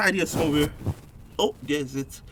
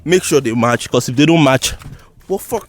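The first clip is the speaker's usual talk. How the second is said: loud; 11 dB louder than usual. Real, not acted. Loud instructions.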